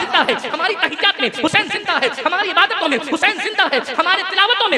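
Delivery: loud, rhythmic preaching; impassioned, pounding oratory; rousing sermon refrain. Real, not acted. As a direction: drive the refrain at the crowd.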